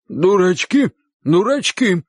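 high-pitched, simple-minded uncle; terse reassurance. A narrator's reading. Uncle soothing the man about the boys, high and terse. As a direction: repeat it high, in two separate bursts. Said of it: "односложно успокаивал его дядя".

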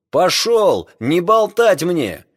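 high, loud angry order; shouting over wind. Acted. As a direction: bark it over the storm. Captain orders over the storm.

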